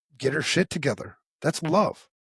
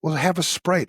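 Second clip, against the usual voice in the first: incredulous; 2 semitones higher than usual. Retold or imitated. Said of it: "I'm like".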